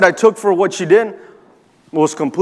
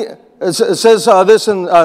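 ordinary talk

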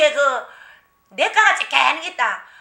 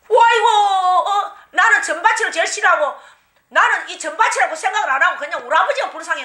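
wailing spirit cry; 4 semitones higher than usual. Retold or imitated. Spirit cries out through her.

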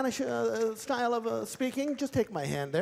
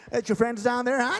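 ordinary talk, nothing marked

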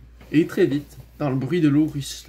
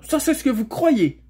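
high, loud comeback; heated retort; 11 semitones higher, 6 dB louder than usual. A narrator's reading. Shasta answering the girl's accusation, heated retort. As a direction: fire it back indignantly. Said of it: "rétorqua Shasta".

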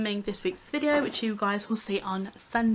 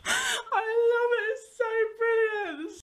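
high, slow, crying with laughter; delighted, teary giggling praise; laughing with delight; 13 semitones higher, slower than usual. Real, not acted. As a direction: gush through tears of laughter. Delighted after prank.

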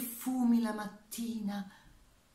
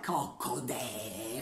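slow low cluck; 8 semitones lower, 4 dB quieter, much slower than usual. Acted. Hen clucking in the poem.

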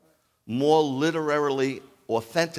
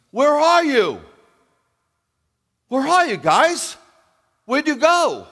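much higher, much louder, calling out; shouting call; 11 dB louder than usual. Shown as a demonstration. Preacher showing how God called out, loud shouted call. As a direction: yell it out like searching for someone lost. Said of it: "He's like".

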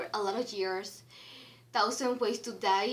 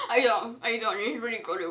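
mouth full; 3 dB louder than usual. Real, not acted. Mouth stuffed, garbled words.

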